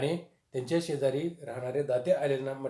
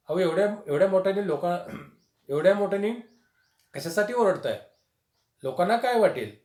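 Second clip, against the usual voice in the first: wife scolding; 6 semitones higher, 6 dB louder than usual. Retold or imitated. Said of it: "पत्नी म्हणते".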